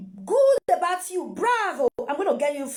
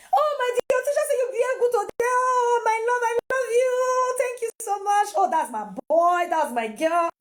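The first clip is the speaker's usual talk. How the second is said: high, laughing gush of praise; lavish the child with delighted praise; overjoyed mother praising her child, laughing; excited praise; 7 semitones higher, 3 dB louder than usual. Acted. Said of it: "show that ex-, as excitement".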